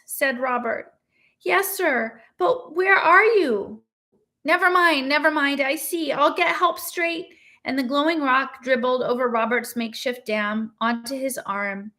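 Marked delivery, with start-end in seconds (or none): 1.4-7.3 higher, eager student voice; hint of a fussy young student; a ghostly student calling out to his professor; lightly voiced student. A narrator's reading.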